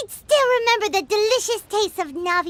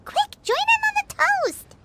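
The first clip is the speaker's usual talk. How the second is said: much higher, bright urgent call; eager urging; 11 semitones higher than usual. Acted. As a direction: hurry a friend into the toast. Urging companion to toast.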